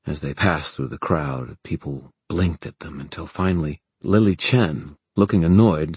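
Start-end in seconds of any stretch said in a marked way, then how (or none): none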